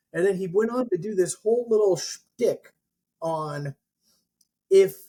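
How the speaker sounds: ordinary talk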